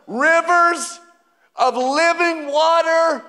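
Jesus proclaiming loudly at the feast. Acted. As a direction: call it out to the whole festival. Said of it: "said in a loud voice".

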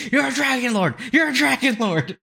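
high-pitched mock yell, no real volume; mock scream. Viewer screaming at the dragon lord on television, high mock scream. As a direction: imitate screaming, pitched high, laughing at the end. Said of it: "Just screaming".

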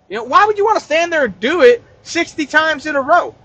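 ordinary talk, nothing marked

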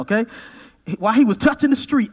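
normal talking voice

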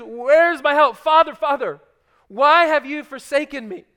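loud, high cry of abandonment; anguished cry. Acted. Jesus on the cross crying to his Father, loud anguished cry. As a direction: cry out to a silent heaven.

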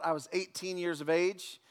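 usual speaking voice

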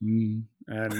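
usual speaking voice

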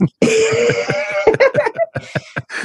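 long high-pitched laughing fit; helpless high giggle; incredulous laugh. Real, not acted. Player recalls the unbelievable invite, laughing in disbelief. Laughs at how crazy it was.

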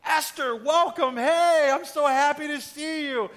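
loud, high, over-the-top greeting; absurdly overjoyed king, drawing out the end; weird gushing welcome. Acted. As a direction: belt out a hammed-up royal welcome. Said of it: "responds in this really weird way".

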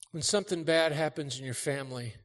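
ordinary talk, nothing marked

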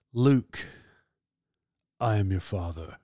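low, soft, deep Vader voice; Darth Vader. Retold or imitated. Quoting Star Wars for viewers, a Darth Vader impression. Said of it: "imitated Darth Vader".

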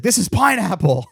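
loud, high sitcom yell; mock outrage. Man scooping lemon ices for his father-in-law, mock outrage. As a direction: blurt it out in hammed-up fury. Acting out angry sitcom ice scene.

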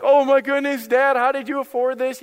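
put-on high, loud, thrilled kid's exclamation; gushing, high-pitched mock amazement; excited kids. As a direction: imitate children amazed by a gift. Voicing his excited kids.

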